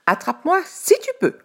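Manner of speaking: very high, loud teasing taunt; cheeky dare. A narrator's reading. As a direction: taunt like a tiny runaway. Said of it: "pancake teasing and fleeing".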